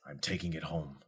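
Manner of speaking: quietly final; Tuft's low resolve. Acted.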